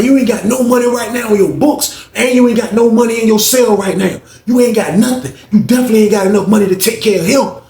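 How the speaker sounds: high, heated retold scolding; berating. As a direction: act out rubbing his failure in. Alvin berates Booker about money.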